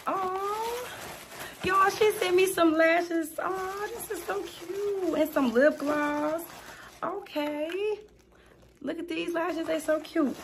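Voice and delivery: squealing with delight over a gift. Real, not acted. Thrilled at gift lashes.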